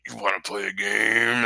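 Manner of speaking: menacing game master growl; Jigsaw voice. Retold or imitated. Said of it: "the, like".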